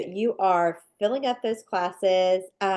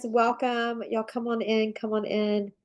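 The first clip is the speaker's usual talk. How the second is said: blocked nose from a sinus infection; talking through a stuffy nose; nasal voice. Real, not acted. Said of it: "I apologize for my nasally, um, voice here".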